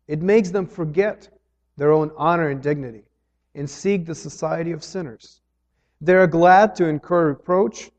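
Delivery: loud, measured reading of a quoted text; solemn quotation read to the congregation; formal reading. Acted. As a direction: read the passage aloud with preaching weight. Reads Bonhoeffer quote aloud.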